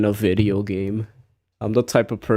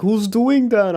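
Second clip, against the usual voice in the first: much higher alarmed mock cry; panicked players; 11 semitones higher, 4 dB louder than usual. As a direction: imitate players freaking out, pitched up high. Other players freaking out.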